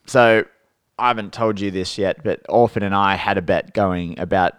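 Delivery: normal talking voice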